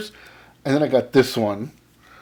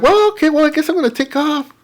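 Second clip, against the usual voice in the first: high retold goodbye; 12 semitones higher, 4 dB louder than usual. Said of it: "I said".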